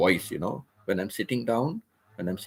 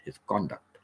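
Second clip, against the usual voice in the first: "conduct" as CON-duct, lower and softer; emphasis on CON; 6 semitones lower, 5 dB quieter than usual. Shown as a demonstration. Teacher confirms the noun, "conduct" stressed on CON, softer. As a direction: quietly stress the first syllable of "conduct". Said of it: "more emphasizing on con".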